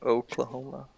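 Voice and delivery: the O's in Oklahoma sounded out softly; trying local O's. Shown as a demonstration. Trying how locals pronounce O's, Oklahoma said softly with attention on its O's. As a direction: quietly test the O's in Oklahoma. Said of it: "how we pronounce O's".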